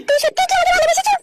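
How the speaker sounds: put-on girl Sumaiya voice. Same girl voice.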